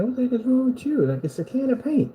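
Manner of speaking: high mock-puzzled wife voice; a hammed-up baffled wife; exasperated wife. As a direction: squeak out her disbelief.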